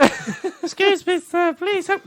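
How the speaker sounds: high, slow pleading squeak. Pretend. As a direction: beg for help in a tiny high voice. Pleading drowning person, very high.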